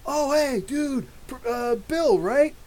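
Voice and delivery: high-pitched starstruck fan, hesitating over the name; excited fan greeting. Retold or imitated. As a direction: imitate a fan spotting someone they half recognize. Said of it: "Every time somebody's like".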